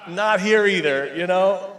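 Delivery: amused loud retort; laughing. Retold or imitated. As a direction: laugh out the punchline. Retelling wife's food cravings.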